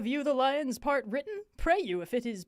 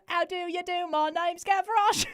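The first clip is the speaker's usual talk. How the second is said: a hammed-up Cockney accent pitched higher; obnoxious Cockney accent; 7 semitones higher, 4 dB louder than usual. Shown as a demonstration. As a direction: play the loud, cheeky Cockney lad, a little higher. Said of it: "I have a way to get into a Cockney accent".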